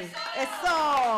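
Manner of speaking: big drawn-out cheer; cheering shout. Real, not acted. Host rallies applause after the trova, cheering shout.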